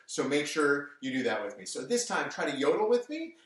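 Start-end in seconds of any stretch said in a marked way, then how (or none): none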